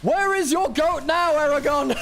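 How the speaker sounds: mocking jab. Real, not acted. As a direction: gloat at the fan. Mocking a fan's favourite.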